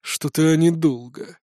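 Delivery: put-on slow old man's grumbling; drawn-out, wary muttering; suspicious grumble. A narrator's reading. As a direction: grumble to yourself, slowly. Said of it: "с подозрением уставился на дуэльный купол и проворчал".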